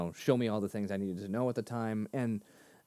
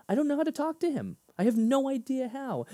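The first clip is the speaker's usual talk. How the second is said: much higher, strained worry; high anxious inner voice; 13 semitones higher than usual. Retold or imitated. Fretting about talking to pastor, strained and high. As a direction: let the panic push the voice way up. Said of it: "inner voice about pastor".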